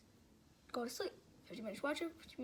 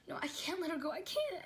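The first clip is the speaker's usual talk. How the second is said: mildly distressed, higher-pitched plea; retold scared pleading; 5 semitones higher than usual. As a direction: imitate a scared, crying protest without real tears. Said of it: "of course, I was scared".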